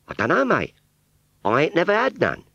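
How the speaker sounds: put-on high Cockney girl's voice; rag trade Cockney. Scarecrow model answering Wurzel in high Cockney. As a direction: pert Cockney shopgirl reply.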